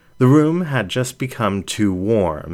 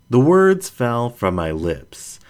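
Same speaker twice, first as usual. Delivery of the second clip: plain reading voice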